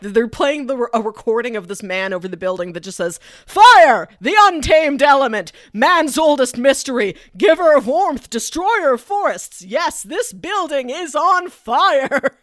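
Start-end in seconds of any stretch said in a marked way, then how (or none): 3.4-12.4 booming, grandiose announcer proclaiming; very loud, higher, epic voiceover read; dramatic announcer. Retold or imitated. Recorded alarm voiceover quoted.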